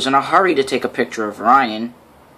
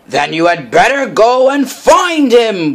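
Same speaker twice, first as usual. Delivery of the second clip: loud, high, slow boom; 8 semitones higher, 6 dB louder than usual. A narrator's reading. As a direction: boom it out like the stern boss. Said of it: "boomed Sir Topham Hatt".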